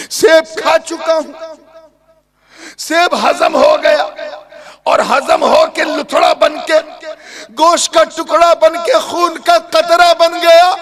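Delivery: high, loud, tearful wail; frantic tearful lament; anguished plea. Acted. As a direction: cry it out in panic to God. Youth panics, praying aloud.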